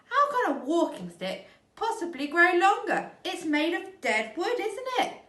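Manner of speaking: crying out. Acted. Missus Twit protesting to her husband, crying out. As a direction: cry out in shocked protest. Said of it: "cried Missus Twit".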